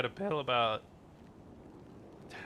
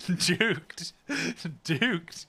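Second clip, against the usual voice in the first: gloating; 4 semitones higher, much slower than usual. Real, not acted. Gloating after dodging.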